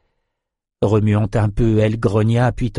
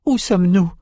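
high, frightened trembling voice; quavering with fear; 9 semitones higher than usual. Acted. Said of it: "d'une voix tremblante".

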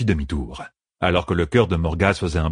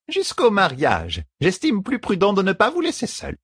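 prim stern servant; 13 semitones higher than usual. A narrator's reading. Servant refusing to leave the lovers alone, stern. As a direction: lay down the rule, pitched high.

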